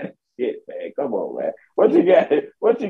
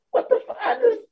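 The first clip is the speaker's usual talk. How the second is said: a much higher, incredulous what the fuck; exasperated squeal; faster than usual. Real, not acted. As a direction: squeak it out in disbelief.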